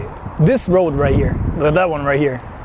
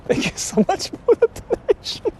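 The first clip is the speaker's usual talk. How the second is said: laughing; 4 dB quieter than usual. Real, not acted. Thanking donor while laughing.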